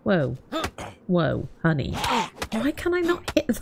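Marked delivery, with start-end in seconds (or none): 2.5-3.6 high, incredulous; exasperated squeak. Real, not acted. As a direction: squeal in frustration then whisper. Can't hit the target.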